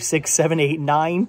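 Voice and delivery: ordinary delivery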